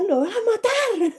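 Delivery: high, slow, fearful cry in a changed voice; the horrified little sister; frightened gasp. Retold or imitated. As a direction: sister fearing the father will kill the brother, high frightened cry. Watching father and brother fight.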